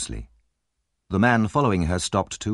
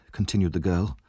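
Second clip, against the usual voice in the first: plain reading voice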